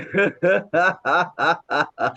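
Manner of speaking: long rhythmic laugh after a nose breath; controlled run of evenly pulsed ha-has; laughter yoga laugh. Shown as a demonstration. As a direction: demonstrate deliberate, sustained exercise laughter. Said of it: "और फिर हंसते हैं".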